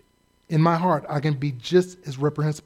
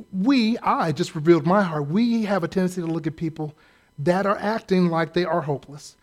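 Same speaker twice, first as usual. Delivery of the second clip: normal talking voice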